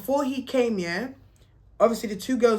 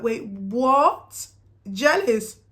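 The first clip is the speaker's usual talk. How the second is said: louder, higher, stunned; shocked disbelief; 3 semitones higher, 6 dB louder, much slower than usual. Retold or imitated. To the man claiming jealousy, loud stunned disbelief. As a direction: react as if you can't believe it.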